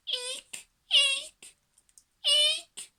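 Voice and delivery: tiny, very high eeks; hamster squeaks. A narrator's reading. Little hamster joins the din, very high squeaking. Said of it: "even the little hamster said".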